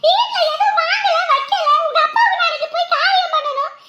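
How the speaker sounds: high, loud, heated tirade; furious scolding. Acted. Mother catches son taking money daily, furious scolding. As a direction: lay into the son, pitch soaring.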